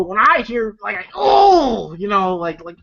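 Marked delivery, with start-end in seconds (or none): 1.1-1.9 pained oh. Retold or imitated. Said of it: "And even I go".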